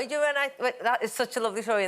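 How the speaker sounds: ordinary talk, nothing marked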